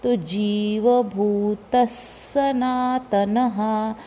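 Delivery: very slow जीवभूतः सनातनः with visarga sounded; slow recitation. Shown as a demonstration. Teacher sounding the visarga for students, very slowly. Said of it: "विसर्ग का उच्चारण भी स रहेगा".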